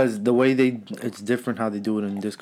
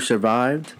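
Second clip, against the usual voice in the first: ordinary talk, nothing marked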